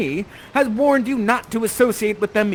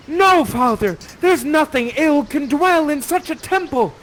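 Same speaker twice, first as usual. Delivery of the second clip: high, loud protest; Miranda; 7 semitones higher, 3 dB louder than usual. Acted. Miranda defends Ferdinand to her father, high protest. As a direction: plead the case earnestly and emphatically. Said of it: "Miranda says to her father".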